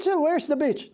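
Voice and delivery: high retold quote; sheepish retelling. Dad repeating his blunder to the kids in the car. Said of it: "what did you say?".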